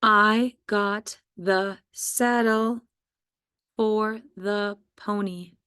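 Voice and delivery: very slow, word by word; slow teacher dictation of "I got the saddle". Shown as a demonstration. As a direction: dictate it slowly for spelling. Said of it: "Let's go ahead and do our sentences".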